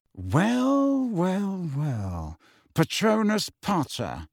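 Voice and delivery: drawn-out high well, sinking to a cool sneer; cool Malfoy. A narrator's reading. Malfoy sneering at Harry in the Ministry, cool and slow. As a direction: greet the boy with icy mock delight. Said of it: "said Lucius Malfoy coolly".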